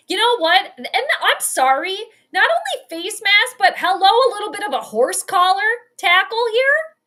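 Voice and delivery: loud, high, indignant protest; real indignation. Real, not acted. Fan protesting the horse collar tackle, loud and indignant. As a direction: pitch it up in outraged disbelief.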